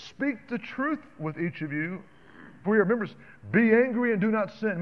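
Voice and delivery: slow, rising preacher's scripture read. Acted. Preacher reading the verse to the church, slow and rising. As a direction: build the verse up to full preaching force.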